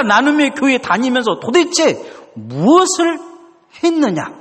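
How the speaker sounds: high, climbing insistence; God's accusing question. Retold or imitated. God asking the church what it has done, high and rising. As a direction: build the question to a peak. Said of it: "물으시고 계시는 것이죠".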